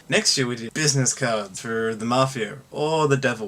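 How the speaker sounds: ordinary talk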